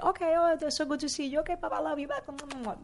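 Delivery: high, airy singsong farewell voice; sweet high goodbye. Retold or imitated. Saying a sweet goodbye to James, high singsong voice. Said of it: "And I was like".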